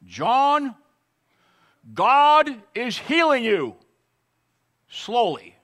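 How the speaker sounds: high, loud, slow announcement; doctor's loud proclamation. Retold or imitated. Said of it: "he turns around and says".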